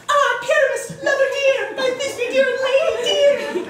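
a hammed-up dainty lady falsetto; tiny monster trying fierce. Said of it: "I'll speak in a monstrous little voice".

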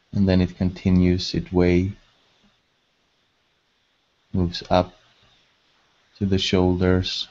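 measured, level delivery, leaving space to breathe; guided meditation. Acted. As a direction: guide them gently into calm, phrase by unhurried phrase.

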